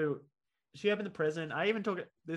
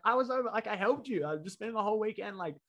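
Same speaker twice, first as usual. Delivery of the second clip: indignant protest; 5 semitones higher, 4 dB louder than usual. Retold or imitated. Retold protest of innocence to sister.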